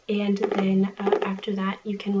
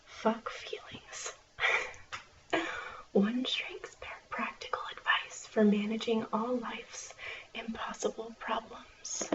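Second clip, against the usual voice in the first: library whisper. Acted.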